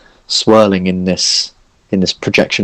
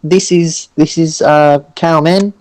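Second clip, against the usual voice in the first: high, stuttering character voice; nervous, hesitant Orson; 6 semitones higher, slower than usual. Acted. Orson introducing his companions to Ciro, nervous and high. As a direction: fumble politely through introductions, pitched up. Game master plays Orson.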